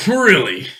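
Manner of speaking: high, loud disbelieving really; genuinely taken aback; a sharp startled question; surprised. Acted. Surprised at coal and oil.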